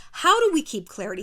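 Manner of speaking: normal talking voice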